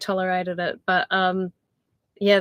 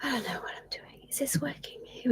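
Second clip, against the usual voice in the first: unvoiced anxious whisper to herself; whisper, unsure if anyone hears; 5 semitones higher, 12 dB quieter than usual. Real, not acted. Streamer whispering while checking her broken live stream. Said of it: "stream not working, unsure".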